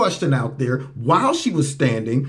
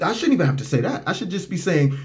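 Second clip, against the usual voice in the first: ordinary talk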